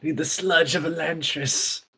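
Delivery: slow, high, breathy craving; frail breathless old person. Pretend. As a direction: wheeze out a desperate need. Mocking a sick infected person.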